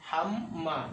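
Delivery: হাম মা in two parts, m sounded twice; doubled m demo. Shown as a demonstration. Teacher shows learners a tashdid letter said twice, doubled-m demo. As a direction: close হাম on m, then start মা on m. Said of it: "এক অক্ষরকে আমরা দুবার উচ্চারণ".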